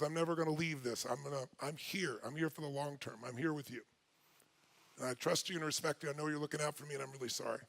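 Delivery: very soft, low and sincere; tender commitment. Retold or imitated. Wife promising she's here long term, retold tenderly. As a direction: pledge it in a hushed, loving voice. Retells wife's loving commitment.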